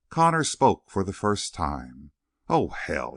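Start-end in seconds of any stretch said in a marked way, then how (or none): none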